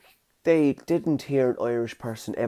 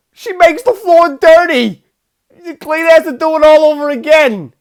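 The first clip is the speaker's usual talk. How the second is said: Christopher Walken; 11 dB louder than usual. Retold or imitated. Acting out Walken's psychic warning, shrieked in alarm. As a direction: shout it in escalating mock horror. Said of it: "He's like".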